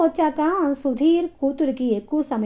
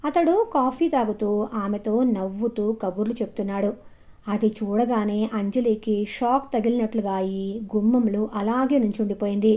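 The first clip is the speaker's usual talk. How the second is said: ordinary delivery, nothing marked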